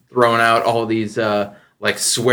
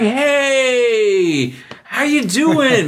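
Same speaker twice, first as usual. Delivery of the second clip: much higher, drawn-out, gushing hello; schmoozy coworkers; 11 semitones higher, much slower than usual. Retold or imitated. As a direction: ham up an overly friendly greeting. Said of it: "They're like".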